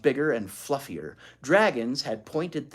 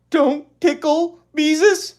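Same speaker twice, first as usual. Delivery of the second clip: put-on high, slow, pleading child voice; begging little girl; 14 semitones higher, 11 dB louder, slower than usual. A narrator's reading. Ramona begs her sister not to tickle, high pleading. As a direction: beg like a scared little sister. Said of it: "she begged".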